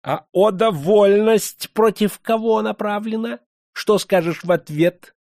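high, loud, slow demand; sharp. A narrator's reading. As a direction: cut in sharply. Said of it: "резко вымолвил".

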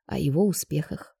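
ordinary delivery

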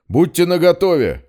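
high loud warning shout. Acted. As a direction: warn the crowd with force. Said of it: "предупредил Петрович". (громкое предупреждение)

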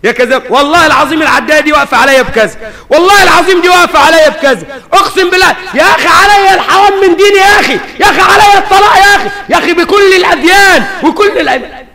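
fast, high, shouted mock oaths; a trader's frantic fake oaths, shouted fast; lying trader swearing. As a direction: ham up the cheat swearing on everything.

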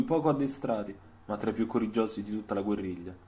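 ordinary delivery

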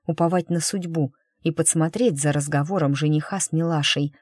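usual speaking voice

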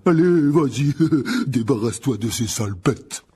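loud, jolly urging with a chuckle; cheerful. A narrator's reading. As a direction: egg the patient on heartily. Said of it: "dit Hagrid d'un ton joyeux". (encouragement joyeux et tonitruant)

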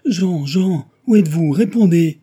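loud, high, insistent calls; calling out urgently. A narrator's reading. Guests call vanished Jean to answer, calling out urgently. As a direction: shout for a missing person to answer.

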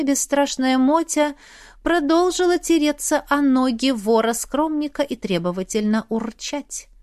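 usual speaking voice

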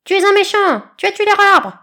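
very high, loud shriek; screaming eaglet. A narrator's reading. Eaglet shrieks at the snake. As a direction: shriek the accusation.

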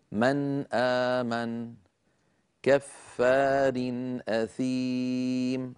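slow recitation of "من آمن كفار أثيم", noon kept short and separate; clear izhar. Shown as a demonstration. Teacher repeating the hamza examples for viewers, "من آمن كفار أثيم" in slow, clear izhar. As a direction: draw out the vowels in "من آمن كفار أثيم", never the nasal hum. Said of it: "هكذا".

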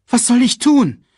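high, loud helpless plea; desperate child. A narrator's reading. Simba asks Scar what to do, desperate. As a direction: cry out for guidance.

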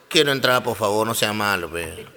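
low, soft, begging little pig; pleading piglet. Retold or imitated. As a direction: whimper your plea, fading away low.

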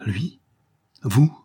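halting question, jumping up on the second word; grated disbelief. A narrator's reading. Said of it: "grinça-t-elle".

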